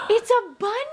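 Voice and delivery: high, bright reveal; excited baby talk. Acted. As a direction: delight the baby with it. Showing baby a toy.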